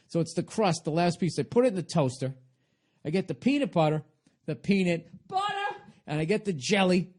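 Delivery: usual speaking voice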